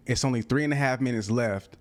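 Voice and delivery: normal talking voice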